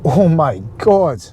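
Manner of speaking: slow, drawn-out oh my God of despair; exasperated groan. Real, not acted. Fed up with the car's voice control, slow and exasperated. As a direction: let it drag out, fed up.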